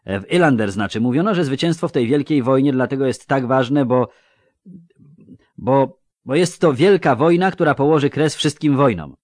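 earnest youth faltering mid-sentence; stammering youth. A narrator's reading. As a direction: trip over a repeated word, then push on. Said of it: "zająknął się Jarre".

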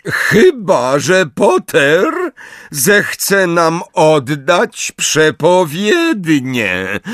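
high, slow gloating voice; gloating Bellatrix. A narrator's reading. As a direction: relish the threat. Bellatrix gloats over Neville. (wysoki, triumfujący głos Bellatrix)

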